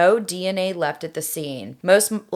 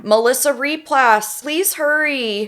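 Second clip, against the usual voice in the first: put-on high, pleading frightened girl; 911 caller; 6 semitones higher than usual. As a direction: beg for help, panicked. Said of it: "I am going to be Melissa".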